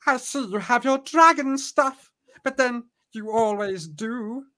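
high put-on voice, slowing at the end; high-pitched sorceress. A narrator's reading. Sorceress remarks to Tarlene, high drawn-out voice. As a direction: coo it high, drawing out the end. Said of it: "says Mordana".

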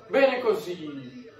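normal talking voice

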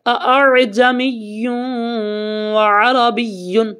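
slow recited اَأَعْجَمِيٌّ وَعَرَبِيٌّ; slow tajweed recitation; Quran recitation. Shown as a demonstration. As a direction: recite it slowly like tilawat.

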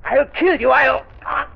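high, shrill-pitched panicked denial and threats; villain's panicked screaming denial; frantic panic. Acted. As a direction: lose control in terror.

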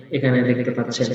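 ordinary talk

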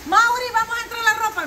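high, loud call to Mauri; mock herself calling him to the laundry; hammed-up call to hang the laundry; imitating her own nagging. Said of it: "No te digo así".

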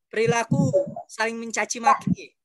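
normal talking voice

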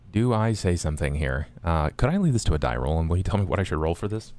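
normal talking voice

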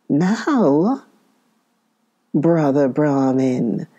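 lightly voiced growling tiger; a hint of a growling tiger. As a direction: menace him like a hungry tiger. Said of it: "growled the tiger".

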